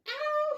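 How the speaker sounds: very high, loud yelp; cry of pain. A narrator's reading. Walter running through the forest, high yelp. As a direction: yelp as he runs. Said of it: "he cried".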